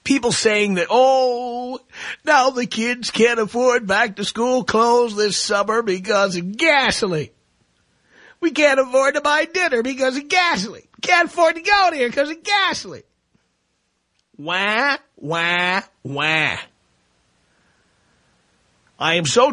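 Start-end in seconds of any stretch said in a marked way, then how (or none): 0.9-13.0 whiny complainers. Retold or imitated. Host mocking people who can't afford dinner because of gas, whining. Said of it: "people are whining a little too much". 14.4-16.6 spelt-out "wah" stepping down in pitch; mock baby crying. Host mocking gasoline complainers, mock crying. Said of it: "crying and whining".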